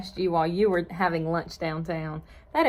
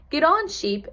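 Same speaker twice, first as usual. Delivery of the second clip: higher, urging call; shouting together; 8 semitones higher than usual. A narrator's reading. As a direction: urge Sheep to climb on. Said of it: "everyone shouted".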